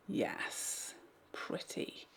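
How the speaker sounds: soft unvoiced whisper; whispered approval. Real, not acted. Vlogger admiring her eyeshadow in a whisper. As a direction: breathe the words to yourself.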